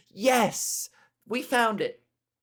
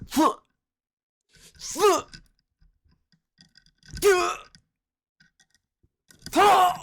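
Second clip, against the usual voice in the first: sharp high grunts like throwing punches; cartoon fight noises, hammed up; mock punch grunts; 10 semitones higher, 6 dB louder, slower than usual. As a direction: grunt each swing, pitched up and loud. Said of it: "cocking back their fist and going".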